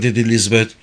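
usual speaking voice

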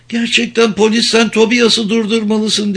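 high, thin plea from a worn-out captive; high-pitched, urgent small voice; strained small voice. A narrator's reading. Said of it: "dedi kısık sesle".

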